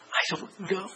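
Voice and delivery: very soft, ashamed reply; sheepish mumble. Retold or imitated. Caught teenage boys to pastor, soft sheepish mumble. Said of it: "sheepish teenagers answering".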